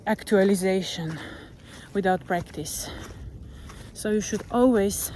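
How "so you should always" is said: normal talking voice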